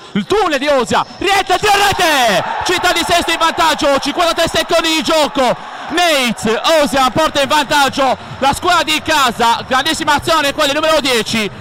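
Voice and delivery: erupting goal commentary; breathless italian goal commentary. Real, not acted.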